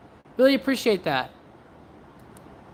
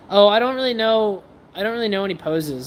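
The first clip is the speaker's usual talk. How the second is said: usual speaking voice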